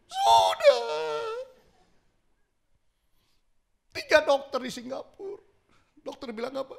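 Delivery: loud, very high tearful wail; crying woman. Retold or imitated. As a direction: plead through sobs. (ibu meratap keras minta didoakan)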